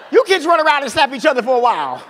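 loud, high mock-mom order; hammed-up scolding mom; exasperated mother. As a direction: holler at the kids like a tired mother. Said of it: "something my mother used to take".

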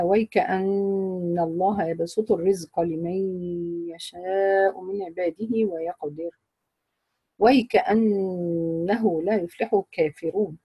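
slow, measured tajweed chanting; Quran recitation. Acted. Teacher reciting the verse from Surat al-Qasas to her students. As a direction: recite the verse slowly and evenly, lingering on the vowels.

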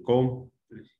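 "cómplice" slowly, weight on a long CÓM; stressed syllable. Shown as a demonstration. Teacher repeating the tónica example, stressed syllable. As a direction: hold CÓM in "cómplice" apart from the rest. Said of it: "las que llevan mayor fuerza de voz".

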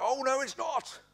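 strained high mock yell; mock-outraged denial at the top of the voice; escalating pantomime shout. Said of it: "they get louder and louder".

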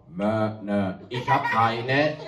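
low stubborn goat, dragged out; a defiant goat who won't look up; cheeky goat. Acted. Goat Tom refuses farmer Otto, low and stubborn.

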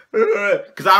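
laughing through the words. Real, not acted. Vlogger laughing at his childhood fear, laughing. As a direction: chuckle while explaining.

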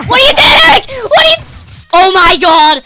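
loud exasperated yelling; angry shout. Real, not acted. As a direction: berate the partner. Yelling at teammate Eric.

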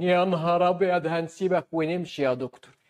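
lightly voiced patient backing away; a hint of put-on dismay; mock-alarmed patient. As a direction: play a patient balking at the doctor's offer. Acts patients recoiling from cortisone offer.

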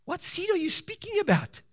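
a lightly voiced puzzled questioner; questioning friend. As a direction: ask it like a puzzled neighbour. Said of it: "people speak like that and they say".

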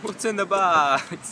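mock interrogator.

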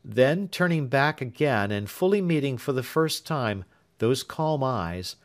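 usual speaking voice

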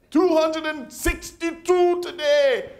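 put-on high, slow boastful old man counting kills; father-in-law proudly reporting ants he killed. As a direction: brag the count like a trophy. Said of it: "he'd love to do that".

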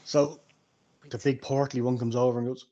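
ordinary talk, nothing marked

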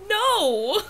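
sharp, loud cry of dismay. Real, not acted. Player seeing the unwanted kangaroo move in, loud no. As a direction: throw out a horrified no. Sees unwanted villager Astrid.

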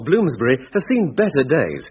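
normal talking voice

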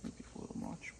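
very soft, voiceless mumble; barely audible. Real, not acted. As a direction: say it under your breath, too low to catch. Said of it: "I'm sorry?".

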